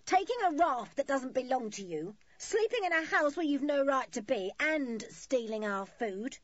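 high, loud scolding; angry accusation. A narrator's reading. Woman accuses children across the water, calling angrily. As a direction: list the crimes in rising outrage. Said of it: "her face red with anger".

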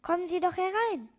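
lifted, inviting call. A narrator's reading. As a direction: call them in from across the room. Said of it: "rief er".

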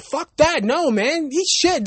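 high-pitched, worked-up retort; indignant comeback. Acted. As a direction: act out telling someone off. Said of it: "I would've said, I'd be like".

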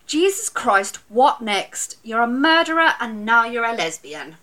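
loud, exasperated mother. Retold or imitated. Mother's exasperated remark retold.

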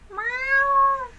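high imitated cat meow. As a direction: meow like the cat calling from the next room. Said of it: "I'll hear him in the living room".

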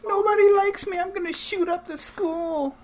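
high, whiny mocking teen. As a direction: pitch way up, whine like a sulky kid. Mocking soft kid.

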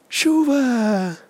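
gasp, then high drawn-out cry; mock shock. Podcaster finding rain on a work morning, mock shock. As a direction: gasp and wail it in mock horror.

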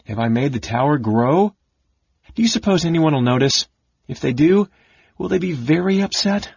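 high, worried kender questions; mounting alarm, rising at the end; alarmed wondering. A narrator's reading. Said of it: "Taz wondered in alarm".

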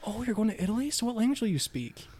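high, innocent questioning voice; a church member naively asking a missionary, high-pitched; naive lady. Retold or imitated. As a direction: ask like a clueless church lady.

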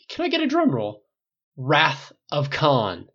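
very slow, loud grand title; milk the title like a big reveal; dramatic reveal. A narrator's reading. Tony revealing the film after a drum roll, slow and grand.

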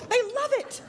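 normal talking voice

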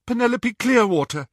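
high frightened voice; frightened but convincing; terrified. Acted. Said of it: "She sounded terrified".

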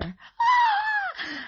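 very high, loud shriek; ear-splitting scream. A narrator's reading. Neighbor sees the man lying on the carpet, screaming. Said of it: "an ear-splitting scream filled the air".

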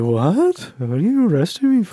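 baffled, innocent voice; clueless guy being arrested. Retold or imitated. Arrested man asking police why, confused. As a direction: play dumb and confused. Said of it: "He's like".

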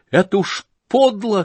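high, loud, near-tearful cry; ashamed shout. Acted. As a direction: cry out in shame. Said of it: "крикнул Коля, совсем плача от стыда".

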